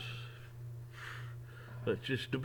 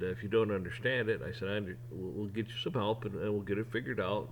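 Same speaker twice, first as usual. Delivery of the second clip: calm, soft and lower, like a patient manager; polite, nice boss; 5 semitones lower, 6 dB quieter than usual. Retold or imitated. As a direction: gently explain the mistake to the worker. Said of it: "and he's polite, and he's nice".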